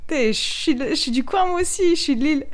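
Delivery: high, loud, eager greeting; excited call out. Pretend. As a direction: call out happily to a fellow islander. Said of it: "j'ai envie de dire".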